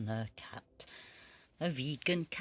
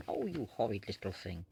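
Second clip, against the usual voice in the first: mock scolding; 11 semitones lower, 4 dB quieter, faster than usual. Real, not acted. Scolds the biting cat.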